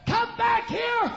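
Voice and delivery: high, slow called-out command; calling after him across the church; retold call. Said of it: "she said".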